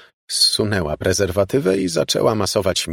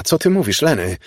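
high-pitched, startled question; alarmed bewilderment; 7 semitones higher, 3 dB louder than usual. A narrator's reading. Woman baffled by her lover's shouting, startled and high.

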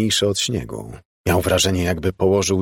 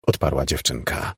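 plain reading voice

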